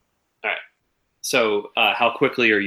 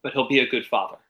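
ordinary talk, nothing marked

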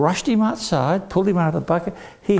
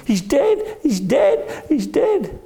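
crying; 11 semitones higher, slower than usual. Acted. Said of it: "Jenny was crying".

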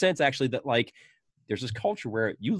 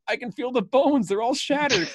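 put-on high, loud pained whimper of an injured kid; whiny Spider; 11 semitones higher, 5 dB louder than usual. Spider whining to the doctors about his foot, pained high voice. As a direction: wail it like a kid with a shattered foot. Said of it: "He's like".

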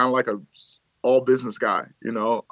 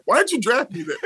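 laughing; 9 semitones higher than usual. Retold or imitated. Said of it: "I'm like".

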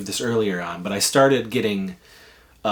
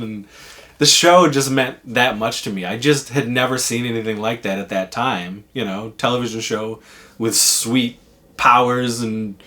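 ordinary talk

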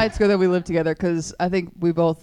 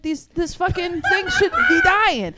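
laughing mock outrage; 11 semitones higher than usual. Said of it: "I was like".

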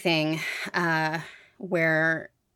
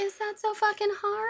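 high, incredulous squeal of disbelief; mock outrage at the service; exasperated mock. Friend mocking the call, high exasperated squeal.

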